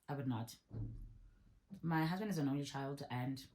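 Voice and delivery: ordinary talk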